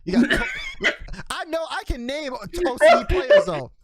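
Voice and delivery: laughing high and loud. Real, not acted. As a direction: laugh through the protest. Laughing protest.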